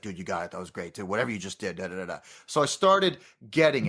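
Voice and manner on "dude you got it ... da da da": laid-back praise. Retold or imitated. Coordinator praising, voiced low.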